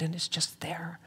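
soft wonder, almost no voice; whisper. Real, not acted. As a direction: breathe it out as a hushed whisper. Awe at a sudden idea.

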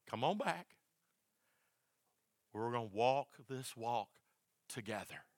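soft, low, reassuring call back; gentle invitation. Retold or imitated. Pastor voicing God calling him to walk together, soft and tender. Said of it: "God goes".

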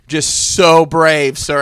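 loud, drawn-out mock praise; super loud. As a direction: say it loudly and slowly so it carries. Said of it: "And I said it, like, super loud".

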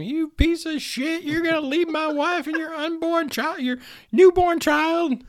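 high, slow mock yell of an angry old man. Father-in-law yelling at Kenny, high mock yell. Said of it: "who was yelling at him".

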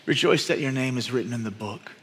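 soft and low, gently reassuring; calm the disciples like a tender teacher; quiet Jesus voice. Acted. Jesus gently tells his disciples, soft and low. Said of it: "And Jesus says".

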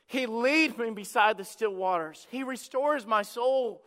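impassioned preaching; preaching at full force. Acted. Pastor preaching hard to the congregation, impassioned. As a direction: preach it out with conviction.

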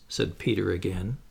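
plain reading voice

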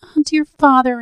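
high, loud tearful plea; child pleading with her father through tears; sobbing child. A narrator's reading. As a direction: cry it out through sobs. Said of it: "as well as her sobs would let her".

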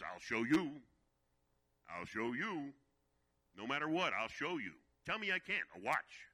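loud, driven "I'll show you"; loud, defiant inner voice vowing to prove them wrong. Retold or imitated. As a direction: throw it back at everyone who doubted. Inner defiant vow, repeated.

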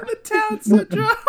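high, slow, breaking into laughter; laughing. Real, not acted. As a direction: squeak it out laughing. Cracking up.

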